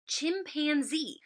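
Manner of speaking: chimpanzee drawn out slowly in three beats; slow, syllable-by-syllable chimpanzee for young readers. Shown as a demonstration. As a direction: stretch chimpanzee out, chim, pan, zee. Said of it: "a really long word".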